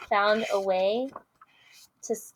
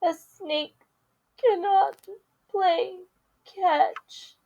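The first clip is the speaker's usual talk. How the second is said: halting, sad snake; 9 semitones higher, much slower than usual. Acted. Snake says it sadly, word by word.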